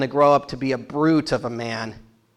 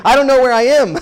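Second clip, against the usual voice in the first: higher and louder, flustered plea; exasperated lost traveller; 7 semitones higher, 6 dB louder, faster than usual. Retold or imitated. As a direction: throw up your hands, totally lost. Jacob lost, asking herders.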